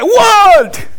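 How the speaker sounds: very high, snapped-back "What?"; teen's annoyed what. Retold or imitated. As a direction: bark back like an irritated kid. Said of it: "Just like".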